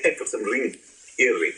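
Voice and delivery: ordinary talk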